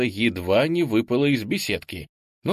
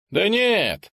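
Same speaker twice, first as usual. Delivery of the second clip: brushing it off, much higher; dismissive; 11 semitones higher, 4 dB louder than usual. A narrator's reading. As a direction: wave the worry away. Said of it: "отмахнулся Емеля". (отмахивается беспечно)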